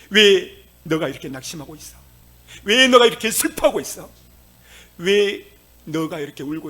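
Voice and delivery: rising loud refrain to oneself; preaching at full force. Shown as a demonstration. Preacher modeling how to confront one's own soul, building loud. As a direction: hammer each question harder. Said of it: "스스로에게 고백하세요".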